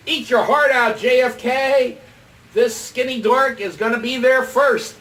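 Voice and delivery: loud, high, crowing taunt; victorious holler at a beaten rival; triumphant shout. Retold or imitated. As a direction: yell it as a gloating boast. Triumphant boast shouted in retold dream.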